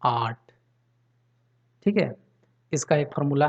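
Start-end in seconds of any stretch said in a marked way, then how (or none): 0.0-0.5 आर्ट as "aat", with no r sound; silent R. Shown as a demonstration. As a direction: drop the र in आर्ट, long aa then t. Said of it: "क्या पढ़ेंगे?". (आर्ट को "आट" जैसा, र चुप)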